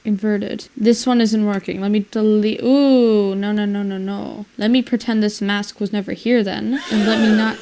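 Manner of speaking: ordinary talk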